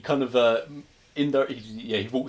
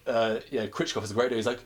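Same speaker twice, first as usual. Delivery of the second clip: ordinary talk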